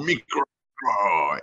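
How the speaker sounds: put-on light French accent on micro, macro; mock French accent. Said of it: "It sounds better in French".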